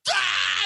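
put-on high-pitched child's "Ah!" jump-out yell; kid's scare yell. Five-year-old jumps out at dad, high startling yell. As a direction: leap out and yell to startle him. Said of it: "his five-year-old kid going".

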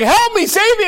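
high, pleading cry for help, half acted; begging friends to save him; desperate plea. Friend caught by the snake, pleading in mock panic. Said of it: "And he's like".

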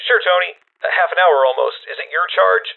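put-on high, eager young attendant; boyish voice. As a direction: pitch it up like a boy. Garage boy answers on the phone.

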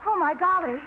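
growing excitement. Acted. Maggie reading the prize telegram, excitement building. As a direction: disbelief building into joy. Reading wire, realizing she won.

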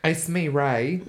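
rough put-on British chav accent; broad mock-British vowels; Chav Rey. Friend joining the impression game, chav British accent. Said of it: "That's like Chav, Rey".

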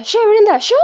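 high, loud Argentine accent imitation. Liliana protesting to Brenda in an Argentine accent, high and loud. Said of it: "con su acento argentino".

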